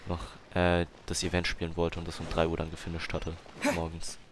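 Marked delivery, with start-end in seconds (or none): none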